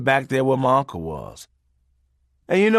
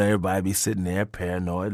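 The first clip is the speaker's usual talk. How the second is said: normal talking voice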